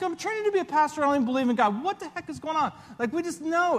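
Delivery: anxious inner rant. Retold or imitated. To himself on the landing plane, anxious. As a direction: race through the worries in your head. Panicked thoughts on descending plane.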